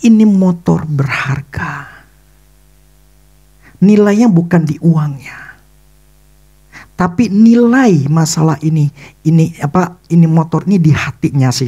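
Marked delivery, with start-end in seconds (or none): 0.1-5.6 slow, hushed sermon emphasis; emphatic whisper. Real, not acted. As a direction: lean in and whisper the key point. Preacher stresses the point, whispered.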